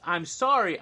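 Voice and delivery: mock apologetic outburst; loud mock apology. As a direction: shout the apology in mock earnest.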